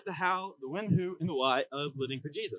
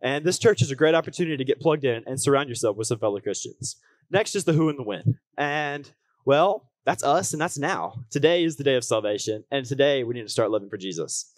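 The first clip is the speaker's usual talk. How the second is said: upbeat youth pastor; 5 dB louder than usual. Acted. Preaching to the congregation.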